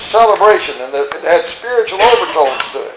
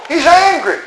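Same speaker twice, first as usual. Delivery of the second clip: usual speaking voice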